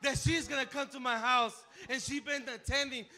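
loud, high, beaming with joy; excitedly telling the church; joyful news. Real, not acted. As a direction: share the good news with delight. Joyful announcement of mother's recovery.

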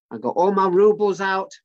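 ordinary delivery

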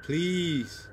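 soft, slow, halting prayer; hushed prayer. Pretend. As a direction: pray quietly for protection, with long pauses. Anxious prayer during scary story.

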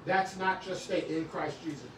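usual speaking voice

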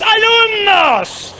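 high, long-drawn schoolteacher call; maestra llamando. Acted. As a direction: summon the whole class in one stretched shout. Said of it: "se paraban y decías". (grito agudo y alargado de maestra)

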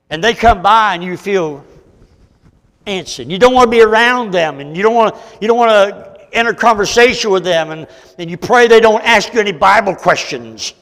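loud, high, driving preacher; full-force preaching. Acted. Preacher describing dodging a godly brother, full-force preaching. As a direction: push it out high and loud at the congregation.

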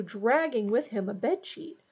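plain reading voice

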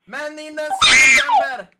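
high, loud shout to clear off; caveman yell. Acted. Az ősember elkergeti a szép embert, kiabálva. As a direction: chase him away with a cry.

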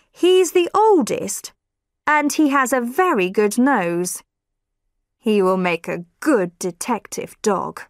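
a hint of a kindly neighbour. A narrator's reading. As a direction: hand over the dog, proud and unhurried. Neighbour voiced in story.

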